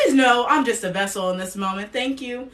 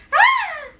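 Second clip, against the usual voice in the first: much higher, delighted cry; excited squeal; 9 dB louder than usual. Real, not acted. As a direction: squeal with joy at the guest. Gushing over the guest.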